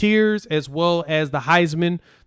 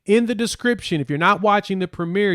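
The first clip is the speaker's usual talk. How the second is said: ordinary delivery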